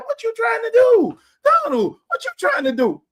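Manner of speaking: high, loud exasperated yell at Donald; incredulous shout. Real, not acted. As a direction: shout it in disbelief. Calling out the president.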